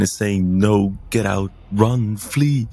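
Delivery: normal talking voice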